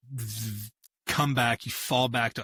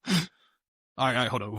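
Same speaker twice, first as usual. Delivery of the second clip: ordinary delivery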